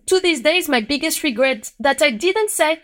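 ordinary talk

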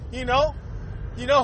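usual speaking voice

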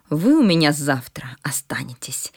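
whispered threat; menacing whisper. Acted. Stern headmistress threatens Sara. (угрожающий шёпот)

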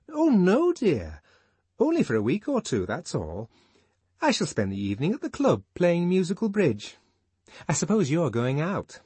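high, quick fussy woman; Fanny's voice. Acted. Fanny chatting to Lenina, high and quick.

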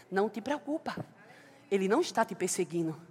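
soft, low reassuring voice; the Lord consoling her, soft and low; gentle comfort. Acted.

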